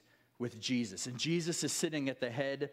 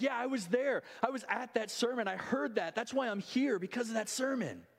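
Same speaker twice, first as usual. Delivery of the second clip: higher, eager mock cheer; cheering crowd; 6 semitones higher than usual. Three thousand saved cheer for Peter's sermon, excited. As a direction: shout it back like a proud convert. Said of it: "start cheering and shouting".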